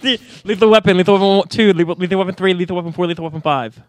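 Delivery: loud, excited rush; frantic rapid listing. Real, not acted. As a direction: blurt them out fast. Rushed timed answers, pressure.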